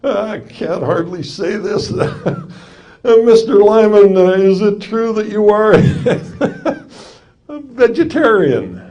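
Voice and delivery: a mocking courtroom question broken up by giggles; laughing while asking. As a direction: barely get the words out for laughing. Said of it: "laughing and giggling".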